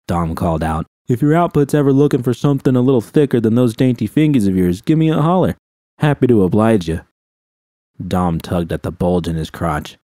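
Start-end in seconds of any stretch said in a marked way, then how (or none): 1.1-7.0 leering taunt. Acted. Dom lewd taunt.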